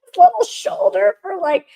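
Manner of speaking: laughing through real tears; teary laugh. Real, not acted. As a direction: crack into a tearful laugh. Crying on son's shoulder.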